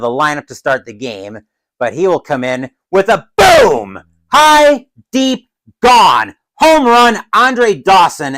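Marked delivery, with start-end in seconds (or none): 2.9-8.4 higher, louder play-by-play burst; excited home-run call. Pretend. Commentator announces Dawson's home run, excited. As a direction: call the homer big, then ease down.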